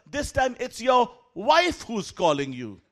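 slow, loud insistent call; urgent shout. Retold or imitated. Secretary shouting that his wife is calling, loud and slow. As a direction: shout it so he finally listens.

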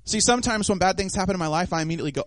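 ordinary talk, nothing marked

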